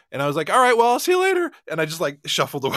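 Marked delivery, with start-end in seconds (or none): 0.5-1.5 high, loud, rushed farewell; hasty mock goodbye. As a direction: blurt a too-cheery exit line. Acts out hurried escape goodbye.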